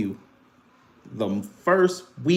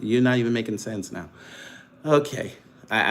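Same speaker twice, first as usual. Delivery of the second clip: usual speaking voice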